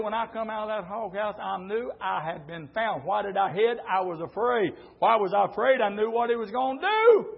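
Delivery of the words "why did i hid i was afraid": usual speaking voice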